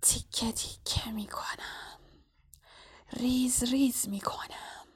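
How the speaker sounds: slow, drawn-out eerie whisper; menacing whisper. A narrator's reading. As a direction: whisper the threat like a disembodied killer. Disembodied murderous voice Harry hears. (نجوای آهسته و تهدیدآمیز)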